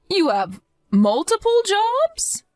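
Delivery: stunned, can't believe she heard multiple jobs; mother stunned by her daughter-in-law's workload, shocked rise; shocked question. Acted.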